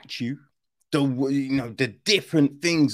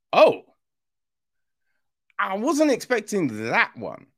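surprised viewer; 6 semitones higher than usual. Retold or imitated. Viewer caught off guard.

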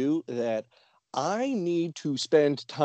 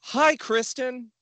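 mimicking caller; 9 semitones higher, slower than usual. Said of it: "And she said".

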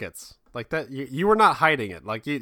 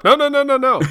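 high panicked pleading; 13 semitones higher, 6 dB louder than usual. Pretend. Playing the clone begging.

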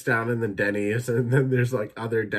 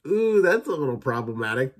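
a touch higher, louder uneasy ooh; awkward cringe; 4 semitones higher, 4 dB louder than usual. Retold or imitated. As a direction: wince at it awkwardly. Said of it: "I'm just like".

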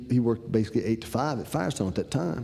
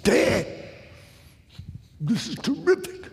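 much higher, gushing rise on terrific; delighted praise; 12 semitones higher than usual. Retold or imitated. Son thanking dad for dinner, high and gushing. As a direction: gush about the meal like a thrilled kid.

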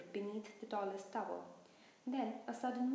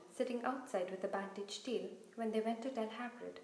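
ordinary delivery, nothing marked

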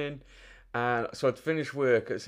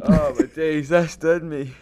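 louder, laughing; 4 semitones higher, 6 dB louder, slower than usual. Real, not acted. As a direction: still laughing as he speaks. Recovering from laughing fit.

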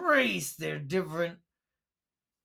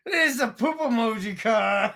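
normal talking voice

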